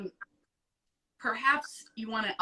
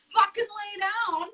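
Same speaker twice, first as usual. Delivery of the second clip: raised, exasperated half-shout, louder; fed-up outburst, higher and louder; exasperated yell; 9 semitones higher, 7 dB louder than usual. Real, not acted. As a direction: yell the obvious fix at them. Exasperated rant at people.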